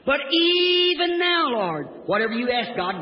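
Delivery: soaring pulpit refrain; preacher's faith cry. Acted. Same refrain of Martha's faith.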